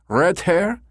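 put-on high, eager German-accented caller. A narrator's reading. Caller asks about the redheads, high German accent. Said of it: "he repeated in his German accent".

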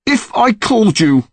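high angry shout through tears; tearful anger. Acted. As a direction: yell it, red-faced and crying. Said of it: "his face boiling red".

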